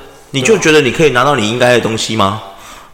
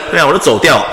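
ordinary delivery

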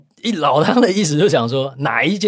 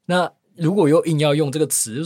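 ordinary talk

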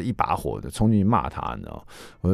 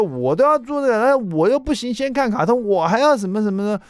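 put-on whiny child; 3 dB louder than usual. Father imitating his son Jason complaining unfairness, whiny. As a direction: whine like a sulky eight-year-old. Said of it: "我又听到Jason说".

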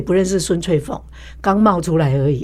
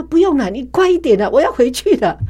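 higher, louder, hurrying them; impatient brush-off; 7 semitones higher, 4 dB louder than usual. Retold or imitated. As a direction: wave off help, eager to leave. Impatient with nurse, retold. (不耐烦地催促，声音提高)

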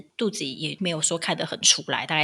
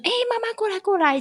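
much higher calling voice; calling out "mom". Retold or imitated. Stranger on the street calling the pregnant host over, high. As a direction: call her over across the street. Imitating someone calling her.

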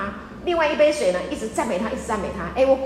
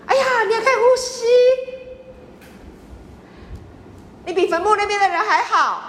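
gushing praise; 7 semitones higher than usual. Acted. Gushing flattery to congregation.

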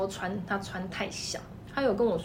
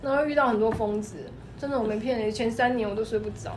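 usual speaking voice

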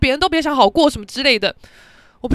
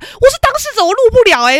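usual speaking voice

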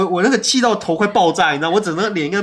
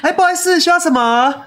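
much higher, louder customer-service voice while still angry; forced politeness; 12 semitones higher, 5 dB louder than usual. Retold or imitated. Angry son serving customers, higher and louder, faking cheerfulness. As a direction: swallow the anger and sound bright. 你已经很气.

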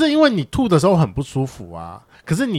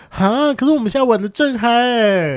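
mock carefree excuse; 11 semitones higher than usual. Mocking friend's excuse to keep partying.

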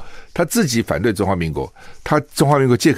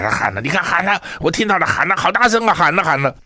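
higher, breathless mock excitement, mocking the media; mock excited; 8 semitones higher than usual. Mocking the reporters who claimed Ma shouted, in hammed-up excitement.

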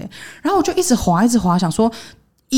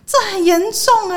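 alarmed; 4 dB louder than usual. Retold or imitated. Reacting to the suicide note.

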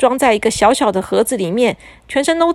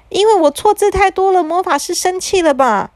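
put-on sheepish child; 9 semitones higher than usual. A narrator's reading. Boy answers mother.

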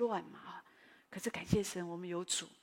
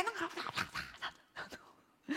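imitated clatter noise; 10 semitones higher, 7 dB quieter, much slower than usual.

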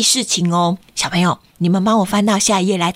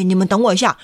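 usual speaking voice

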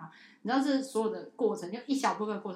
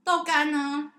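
put-on high, loud sing-song to a child; cheerful kid-talk; 8 semitones higher, 9 dB louder, much slower than usual. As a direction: bounce it brightly.

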